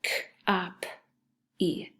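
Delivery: "K-op-E" in separate chunks with pauses; sounded out. Shown as a demonstration. Teacher breaking copy into syllables, "K-op-E" piece by piece. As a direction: isolate K, then op, then a long E. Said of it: "first syllable".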